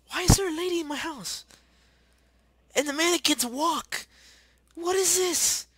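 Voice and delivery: high, loud screech of disbelief; high-pitched incredulous shouting; bewildered yelling. Real, not acted. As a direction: shriek in baffled outrage. Outraged at lady in house.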